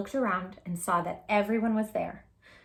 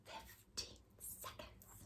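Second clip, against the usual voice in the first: slow breathless whisper; whispered reveal of the time; suspenseful whisper; 26 dB quieter, much slower than usual. Acted. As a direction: hush it, holding the suspense. Reading the timer in suspense.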